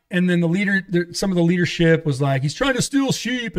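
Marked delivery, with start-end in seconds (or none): 2.4-3.6 high-pitched retold accusing voice; imitated accusation. Church leadership accusing a returning preacher, imitated accusation. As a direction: lift the pitch sharply to mimic accusers.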